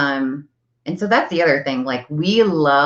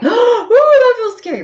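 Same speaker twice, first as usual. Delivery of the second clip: much higher squeaky mock-scared voice; mock fright; 6 dB louder than usual. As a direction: play the timid listener, wide-eyed. Acting scared listener's reply.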